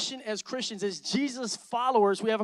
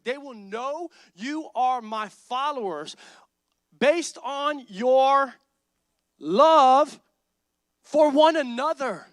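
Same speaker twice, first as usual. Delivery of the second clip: slow preached verse; 3 semitones higher, 7 dB louder, much slower than usual. Real, not acted. Pastor preaching the verse to the church, slow and building. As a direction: stretch it out, building to a loud love.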